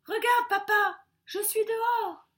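high, loud calling out; triumphant cry. A narrator's reading. Son calls to his father from a branch, crying out. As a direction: crow it to someone far away. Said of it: "pour crier à son père".

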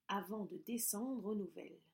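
usual speaking voice

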